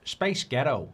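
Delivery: second go at an American accent; attempted American accent. Shown as a demonstration. Streamer retrying after sounding Scottish, attempted American accent. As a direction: try American, let it slip towards Scottish. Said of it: "No, that's Scottish".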